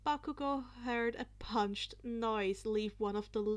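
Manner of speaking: plain reading voice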